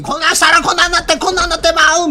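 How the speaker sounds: high, fast, frantic mock alarm; mocking Okuda. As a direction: squeal it like a panicked doomsayer. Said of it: "奥田みたいに". (甲高く早口で大げさに慌てる奥田の物真似)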